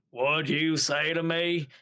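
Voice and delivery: slow mock big-guy voice; burly hillbilly. Burly six-foot-four stranger challenging Edward, slow hillbilly impression. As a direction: imitate a huge hillbilly, drawing it out. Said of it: "hillbilly mustache and beard".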